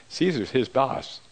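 ordinary talk, nothing marked

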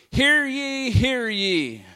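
high, loud ringing proclamation; town crier. Retold or imitated. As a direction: call it out like a town crier in the street. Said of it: "would call out".

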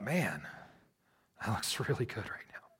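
soft whispered longing; tempted whisper. Retold or imitated. Tempted by cozy bed, inner thought.

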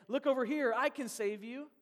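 mock huckster's loud come-on; attention-grabbing sales pitch. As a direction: call out like an advert hawking rescue. Competing allegiances acted as sales pitch.

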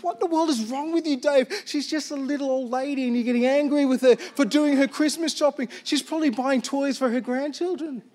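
high-pitched, incredulous telling-off; self-scolding. Retold or imitated. Pastor scolds himself for anger at old lady, incredulous.

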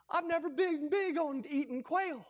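normal talking voice

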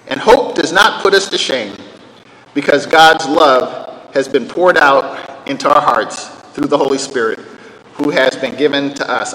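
scripture reading. Acted. Preacher to congregation, reading the verse solemnly.

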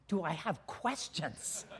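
low, quiet, slow echo; deadpan disbelief. Retold or imitated. Nervous jumper echoing his instructor's question, deadpan disbelief. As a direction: repeat the question under your breath, incredulous.

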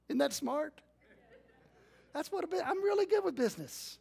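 ironic brag, pitched well up; mock himself as a business genius; sarcastic self-mockery. Self-mocking his bad cattle buy.

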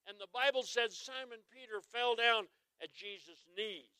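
ordinary delivery

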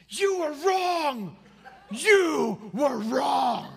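high, very loud, drawn-out mock child yell; child's accusing shout. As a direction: imitate a crying boy shouting an accusation, stretching each word. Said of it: "And he goes".

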